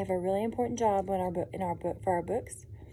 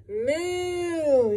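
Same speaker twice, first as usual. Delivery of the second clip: high drawn-out moo; playful cow moo; 7 semitones higher than usual. Acted.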